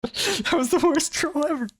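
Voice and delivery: high laugh through the words; squealing with laughter. Real, not acted. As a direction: crack up at the failed troll. Laughing at failure.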